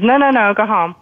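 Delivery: high, brush-off "no, no, no"; dismissive. Retold or imitated. Doctor sending a worried patient home, dismissive.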